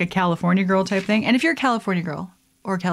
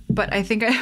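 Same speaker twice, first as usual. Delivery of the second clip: ordinary talk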